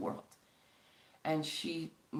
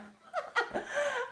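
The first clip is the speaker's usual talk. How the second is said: quick high giggle at herself; short high burst of laughter; 4 dB louder than usual. Real, not acted. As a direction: laugh off the lost glasses. Laughs at missing glasses.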